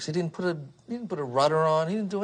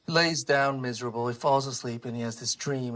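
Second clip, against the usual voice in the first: usual speaking voice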